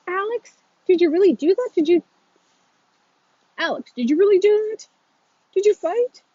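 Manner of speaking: high, loud parental disbelief; shocked questioning. A narrator's reading. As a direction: question your son in alarm. Dad questions son about fight.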